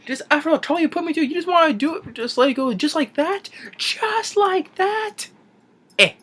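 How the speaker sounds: very high, squeaky alien voice, fast then dragging out the end; high squeaky creature voice; Stitch alien voice. Retold or imitated. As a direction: squeak it out like a tiny outraged alien. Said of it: "talks in his alien language".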